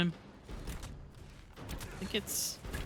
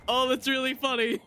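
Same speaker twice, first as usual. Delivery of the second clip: laughing; 7 semitones higher than usual. Real, not acted. Streamer still laughing.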